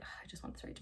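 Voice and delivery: fast whispered thought; a quick breathy inner groan; under her breath. Retold or imitated. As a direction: mutter it to yourself, fed up.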